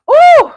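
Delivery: loud high-pitched "ooh" of relief; high excited whoop. Real, not acted. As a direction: let out a loud high squeal. End of set, relief.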